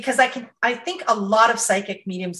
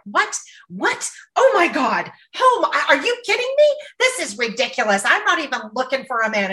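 shocked outrage; 5 semitones higher than usual. Retold or imitated. Client reacts to the prediction.

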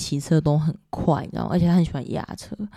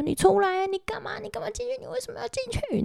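high, frantic plea that fades to almost nothing; begging a lost friend to come out, voice breaking; desperate pleading cry; 6 dB quieter than usual. Retold or imitated. Dream, banging on freezer door. (高声绝望地哭喊)

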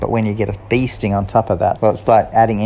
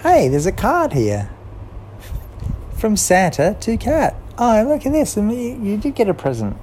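higher, eager voice of Mouse; Mouse excited; 12 semitones higher than usual. A narrator's reading. Mouse telling Kat about her card, higher and eager. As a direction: Mouse finds the card and can't wait. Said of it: "And then Mouse said".

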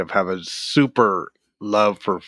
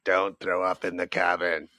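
gruff warning; 3 semitones lower, 7 dB quieter than usual. Retold or imitated. Bird boat captain warning passengers.

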